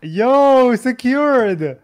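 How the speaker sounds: loud, high cheer. Real, not acted. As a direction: celebrate the win out loud.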